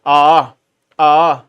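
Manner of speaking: long vowel आ held out; drawn-out aa. Shown as a demonstration. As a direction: stretch the आ long. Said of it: "खींच के बोलेंगे ना".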